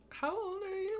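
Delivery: squeaky-high put-on voice; mock character voice. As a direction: imitate her in an exaggerated falsetto. Acts out the aunt's question.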